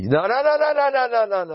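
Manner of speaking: high-pitched rapid string of refusals; sustained high, insistent objection; insistent protest. Acted. Drowning out the 'used to be' excuse.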